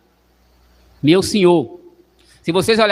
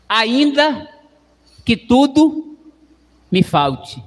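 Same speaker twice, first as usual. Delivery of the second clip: rising to a peak on tudo, then dropping; fervent demo; 3 semitones higher, much slower than usual. Shown as a demonstration. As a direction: show them how beautiful it sounds. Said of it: "Veja como é mais bonito".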